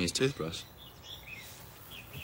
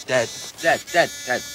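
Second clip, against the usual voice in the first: urgent calling; 5 semitones higher, 8 dB louder than usual. Acted.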